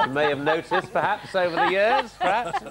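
high, giggly delivery; amused, pitch climbing with laughter; laughing while talking. Real, not acted. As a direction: speak through laughter at your own joke. Laughing through his own pun.

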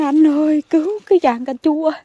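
high, loud call to her husband; calling for help. Retold or imitated. Gardener shouting to her husband for help, loud call. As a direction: cry out for help with the tomato trellis. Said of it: "mình la quá trời luôn".

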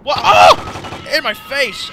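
high, loud shriek of surprise; sudden panicked cry; startled yelp. Real, not acted. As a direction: yelp as something leaps at you. Enemy jumps at him.

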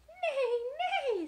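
high, loud whinnying neigh; horse neigh. Acted. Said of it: "said the horse".

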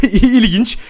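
laughs it out, high and loud; chuckling at the death; laughing. Real, not acted. Gamer laughs as his Sims wife dies.

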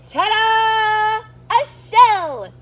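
loud, high triumphant reveal. Acted. Showing the children Norman's new shell, triumphant. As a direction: present it like a magic trick.

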